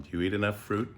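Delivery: ordinary delivery, nothing marked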